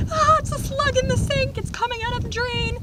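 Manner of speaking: high shrieking alarm, loud at first; panicked squeal. Retold or imitated. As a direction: shriek about the slug. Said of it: "I, like, screamed".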